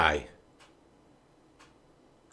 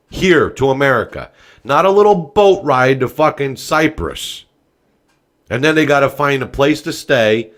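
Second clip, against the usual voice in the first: fired up; 3 semitones higher, 5 dB louder than usual. Real, not acted. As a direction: yell it at the president, all fired up. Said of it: "I'm getting a little fired up".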